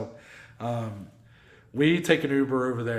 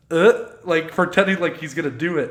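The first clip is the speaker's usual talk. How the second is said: normal talking voice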